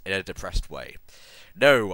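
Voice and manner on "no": loud, higher cry of no; mock sexy scream. A narrator's reading. Ebony screaming at her vision, loud mock scream. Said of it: "I screamed sexily".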